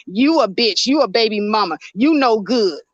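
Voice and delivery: normal talking voice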